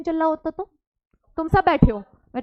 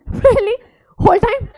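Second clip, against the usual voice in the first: high, loud whiny protest; mock student complaint; 6 semitones higher, 7 dB louder, much slower than usual. Teacher imitating students complaining about the definition. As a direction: whine like a fed-up student. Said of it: "कह रहे हैं".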